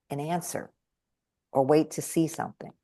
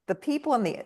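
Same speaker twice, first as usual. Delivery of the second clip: ordinary talk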